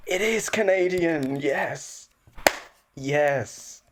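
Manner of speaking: high triumphant yes; excited. Real, not acted. Triumphant confirmation.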